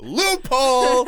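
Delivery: loud, slow gleeful cry of victory; triumphant shout. Real, not acted. Co-host crowing to listeners about her loophole, gleeful shout. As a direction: stretch it out like you found the trick.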